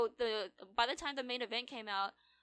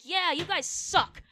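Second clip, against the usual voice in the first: loud, higher, slow gloating taunt; mock taunt; 7 semitones higher, 6 dB louder, slower than usual. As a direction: rub it in like a cocky winner. Imitates winner taunting the table.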